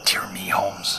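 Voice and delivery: hushed, breathless whisper. Acted. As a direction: whisper it so nobody out here hears. Whispering on the dark moor.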